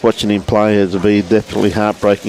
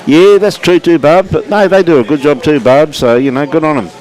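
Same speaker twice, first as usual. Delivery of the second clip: cheery shout-out; 6 semitones higher, 4 dB louder than usual. Real, not acted. Cheerful shout-out to viewer Barb.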